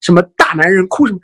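usual speaking voice

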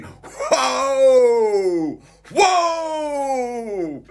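whooping like on a fairground ride; thrilled whoa. Pretend. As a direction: ride the drop with a big whoa. Mock thrill of bullet train.

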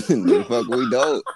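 the retort said again through a laugh; cracking up while repeating the line; amused, laughing replay of the comeback. Real, not acted.

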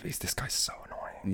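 whispered aside, unvoiced. Dustin whispering that Steve is so annoying, whisper. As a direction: hush it like a secret complaint.

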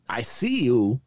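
lightly voiced celebrity impression; a hint of an odd, halting actor; Christopher Walken. Retelling a star's odd greeting to a comedian, light impression. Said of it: "Christopher Walken looks at the dog".

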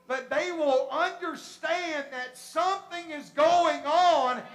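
loud, high, slow and emphatic; full-force preaching. Real, not acted. Preacher explaining the move of God to brothers and sisters, forceful. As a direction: stretch the key words and drive them home.

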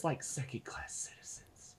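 bitter whisper. Acted. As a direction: drop it to a hiss of a whisper. Bitter line trails off.

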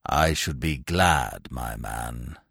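deep, slow, icy gentleman; stern old grandee, deliberately paced; cold, stern Sir Roderick. A narrator's reading. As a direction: address a servant coldly, low and measured.

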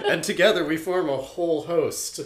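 laughing through the words. Real, not acted. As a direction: giggle out the joke. Laughing while speaking.

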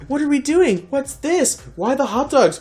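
bewildered. Retold or imitated. Own panicked inner voice.

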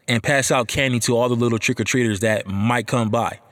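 ordinary delivery

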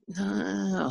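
high, soft, drawn-out nah; hesitant high-pitched objection; mock reluctance. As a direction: stretch it out high in gentle protest. Acts out his hesitant objection.

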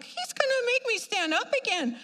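high-pitched mock dismay; pleading complaint. Churchgoer dreading standing up again, exasperated mock protest. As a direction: complain in a high, put-upon voice.

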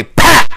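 sudden loud, high pop; explosive sound effect; imitated pop. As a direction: burst it out like an explosion. Imitates the dye pack bursting.

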